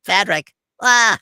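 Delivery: very high, loud cartoon voice; drawn-out squeal of a cartoon sponge; SpongeBob. Shown as a demonstration. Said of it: "A D R'd for you as SpongeBob".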